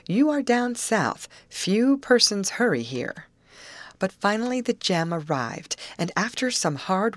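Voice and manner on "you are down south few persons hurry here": slow, higher-pitched drawl; unhurried, emphatic advice; leisurely reminder. A narrator's reading. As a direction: take your time spelling it out. Narrator voices uncle's leisurely reminder.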